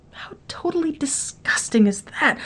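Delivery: whispered disgust; hushed whisper of disgust. Acted. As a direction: whisper it, grossed out.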